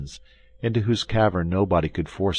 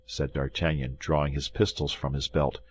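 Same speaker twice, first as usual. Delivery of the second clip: ordinary delivery, nothing marked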